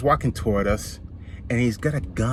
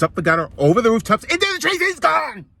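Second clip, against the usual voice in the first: excited; 14 semitones higher, 8 dB louder than usual. A narrator's reading. Squirrel escapes over the rooftops.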